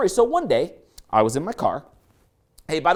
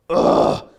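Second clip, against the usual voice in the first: loud, unvoiced guttural roar of frustration; Incredible Hulk groan; 5 dB louder than usual. Driver as the cup explodes, loud Hulk-like growl. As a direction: growl out exasperation like a monster. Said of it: "make a sound like the Incredible Hulk".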